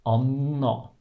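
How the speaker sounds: "Anna" with a drawn-out n; long N. Shown as a demonstration. Teacher showing how we elongate the N, long n in "Anna". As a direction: hold the n in "Anna". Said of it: "we just elongate the N".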